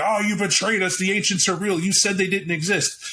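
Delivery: high, agitated accusing voice; upset Xavier. Retold or imitated. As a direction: whine it like he can't calm down. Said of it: "he won't calm down".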